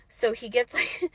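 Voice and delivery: normal talking voice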